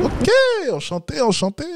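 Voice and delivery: high-pitched imitation of the client; mock eager greeting. Coach mimics the client greeting the woman, mock-eager.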